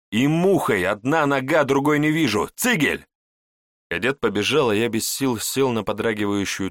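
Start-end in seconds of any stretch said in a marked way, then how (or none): 0.1-3.1 urgent shouting. A narrator's reading. Urgent orders to the cadet.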